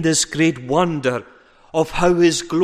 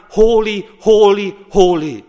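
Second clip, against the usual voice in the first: loud ringing proclamation, each word struck; angels crying holy; 3 semitones higher, 7 dB louder than usual. Acted. Preacher voicing angels before the throne, loud ringing cry. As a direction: cry it out like the heavenly host. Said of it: "are around the throne crying".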